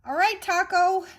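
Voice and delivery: high, loud mock parent voice; hammed-up high parent call. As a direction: call a silly-named kid, high and playful. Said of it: "I wanna make fun of it".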